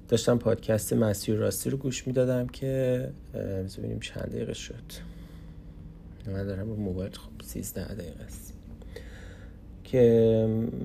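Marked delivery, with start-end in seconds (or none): 3.3-8.5 breathy whisper while checking the phone; whispered aside. Real, not acted. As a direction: drop to a whisper, talking to yourself.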